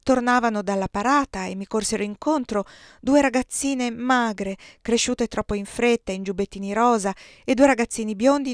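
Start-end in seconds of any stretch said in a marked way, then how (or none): none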